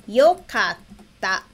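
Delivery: "Yokatta" drawn out very slowly, every syllable clear; slow demo. Shown as a demonstration. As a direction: spell out "Yokatta" by ear for the class. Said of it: "Então, seria".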